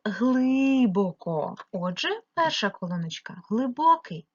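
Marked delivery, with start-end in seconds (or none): 0.0-1.5 глибоко with a long, stressed и; глибоко drawn out on its first vowel; stressed check word. Shown as a demonstration. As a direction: stretch ГЛИ at the start of глибоко. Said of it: "Змінюємо слово".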